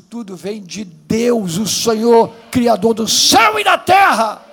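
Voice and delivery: loud, rising preacher's cry; shouted sermon climax; preaching at full force. Real, not acted. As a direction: lift the whole church with your voice.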